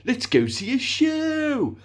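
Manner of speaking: high, drawn-out catchphrase voice; weird silly phrase. Retold or imitated. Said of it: "this weird phrase, where we'd say".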